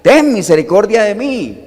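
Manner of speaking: desperate shout to Jesus, loud and high; crying out. Retold or imitated. Blind beggar shouting to Jesus for mercy, loud and high. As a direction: call out to be heard over a crowd. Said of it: "a gritar".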